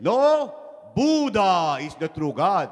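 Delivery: loud contradiction, high at the start; arguing. Acted. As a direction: cut in loudly, then stretch the claim. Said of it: "they were arguing".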